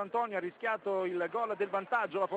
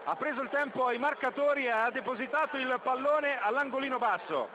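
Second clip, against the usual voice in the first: excited commentary; 6 semitones higher than usual. Real, not acted. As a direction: still buzzing from the goal. Recap of the goal, still elevated.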